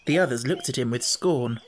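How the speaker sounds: ordinary delivery, nothing marked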